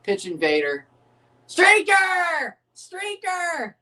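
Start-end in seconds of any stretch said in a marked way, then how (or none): 1.5-3.8 high, sudden cry of streaker; excited call-out. Real, not acted. Calling out a streaker running onto the pitch, excited high yell. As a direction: yelp it out like spotting a runner on the pitch.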